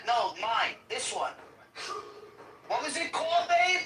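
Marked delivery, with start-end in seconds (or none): 2.7-3.8 higher, louder call to someone away. Real, not acted. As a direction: call out to babe across the house. Calling out to another room.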